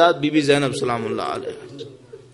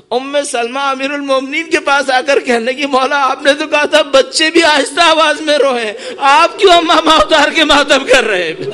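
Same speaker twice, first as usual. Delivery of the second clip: raised, louder mourning sermon voice; impassioned lament preaching; 8 semitones higher, 5 dB louder than usual. Acted. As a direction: build the quoted plea into a high lament.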